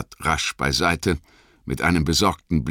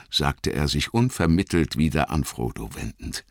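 ordinary delivery

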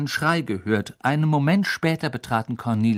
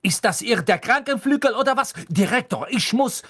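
high, loud, outraged challenge; angry Madame Pomfrey; 12 semitones higher, 5 dB louder than usual. A narrator's reading. Nurse berates visitors in hospital wing, angry. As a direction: protest furiously to your superior. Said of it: "sagte Madame Pomfrey erzürnt".

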